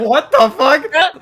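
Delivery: high, slow, drawn-out disbelief; shocked outburst. Retold or imitated. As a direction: stretch it out in stunned disbelief. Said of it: "I was like".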